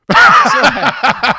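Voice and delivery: mock grudging. Conceding the rival stadium is fine, hammed-up and drawn-out. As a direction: stretch the words like it hurts to admit.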